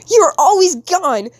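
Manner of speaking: high, loud, drawn-out reproach; slow it down and cry it out; angry accusation. Acted. Kid blaming absent parent, angry loud reproach.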